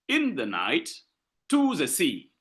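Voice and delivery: two light syllables, then long "night" and "sea"; anapestic stress. Shown as a demonstration. Teacher demonstrating anapests to class, stress on "night" and "sea". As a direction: lean on the final "night" and "sea". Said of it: "This is an anapestic thing".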